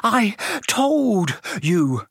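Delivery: high, very slow, a breath between words; winded, drawn-out reproach; gasping. Acted. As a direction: gasp each word out past a stitch. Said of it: "Hermione gasped, clutching at the stitch".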